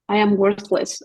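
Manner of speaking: ordinary talk, nothing marked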